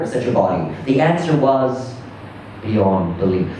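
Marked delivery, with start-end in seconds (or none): none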